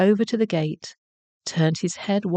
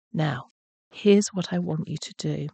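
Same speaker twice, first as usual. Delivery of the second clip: plain reading voice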